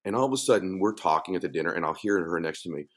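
ordinary talk

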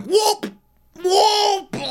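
very high squealed whoops; drunken whoop. Retold or imitated. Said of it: "Vicky's just still going".

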